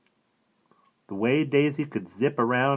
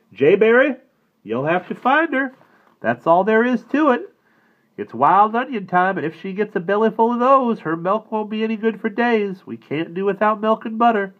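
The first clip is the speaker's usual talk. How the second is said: father giving orders; 12 semitones higher, 7 dB louder than usual. A narrator's reading. Father gives son a job.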